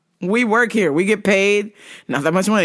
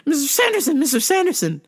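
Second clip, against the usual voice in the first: put-on old lady voice; 6 semitones higher than usual. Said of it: "she's like".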